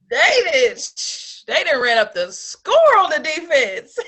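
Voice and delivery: loud laughing tease. Real, not acted. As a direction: laugh through the words. Joking about a lopsided score.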